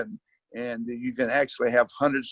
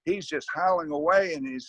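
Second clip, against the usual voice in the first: ordinary delivery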